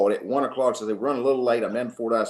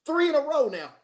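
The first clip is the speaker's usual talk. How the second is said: high-pitched, comic disbelief; mock dismay. Coach counting meals on recruiting visits, mock dismay. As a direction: let the voice climb in mock alarm.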